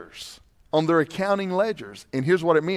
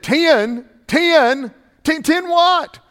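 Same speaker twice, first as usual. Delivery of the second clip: panicked patient; 10 semitones higher, 7 dB louder, much slower than usual. Retold or imitated. Patient panics at doctor's answer.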